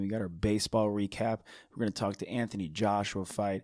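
normal talking voice

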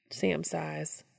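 ordinary delivery, nothing marked

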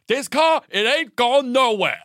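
slow mock dad ultimatum. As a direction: lay down the law on the hard shoulder. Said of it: "and goes".

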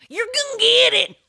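high, loud attempt at backwoods holler; imitated tagline. Irritated at a partner over the dishwasher, imitated backwoods holler. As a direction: copy a friend's hillbilly catchphrase loudly. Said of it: "See, I can't do it like you".

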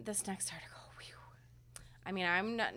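near-tears hushed whisper, voice almost gone; holding back tears. Real, not acted. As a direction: whisper it, fighting not to cry. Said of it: "I'm gonna try to hold it together".

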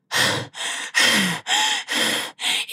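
long unvoiced gasp after a run; mock breathless exhale; out-of-breath gasp. Imitating a fan who arrives panting. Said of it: "runs up and goes".

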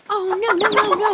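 high, repeated cries of no; acted alarm. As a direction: protest in alarm to stop it. Acting alarm as hen pecks chick.

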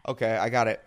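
ordinary talk

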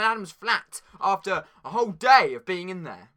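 loud, high TV newsreader voice; mock news anchor. Kid hosting a pretend world news bulletin, anchor voice. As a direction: announce the headline like a newsreader.

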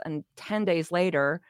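ordinary talk

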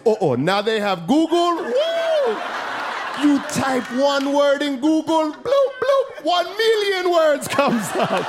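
squeaky high, laughing, badly faked Haitian dad; failed impression of his old Haitian dad; dad's voice. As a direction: flail through a bad dad impression. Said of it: "I can't do my dad's voice".

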